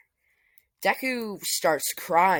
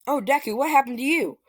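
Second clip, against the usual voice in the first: raised, louder worried father; concerned dad; 9 semitones higher, 5 dB louder, faster than usual. Retold or imitated. Father sees hurt son, mock alarmed call. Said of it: "he's like".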